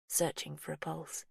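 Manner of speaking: ordinary delivery, nothing marked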